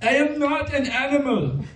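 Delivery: high, slow, pleading cry; cornered by a mob, a hammed-up slow plea in a high voice; hammed-up Elephant Man plea. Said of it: "I would say".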